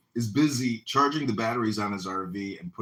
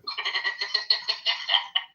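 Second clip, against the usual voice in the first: high rapid chuckle; long staccato run of giggles; laughter; 9 semitones higher than usual. Real, not acted. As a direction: laugh in a quick, high string. Amused after brief interruption.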